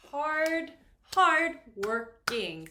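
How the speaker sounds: slow, loud, separated beats on "hard working"; syllable by syllable. Shown as a demonstration. Teacher counting three syllables for students, syllable by syllable. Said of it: "So three syllables".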